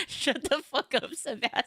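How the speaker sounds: laughing through the line, slowed; cracking up while saying it; giggly mock scolding; laughing quote. Quoting the meme line laughing.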